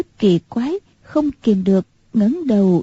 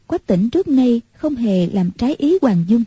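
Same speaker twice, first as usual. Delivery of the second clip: ordinary delivery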